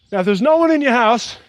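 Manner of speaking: ordinary talk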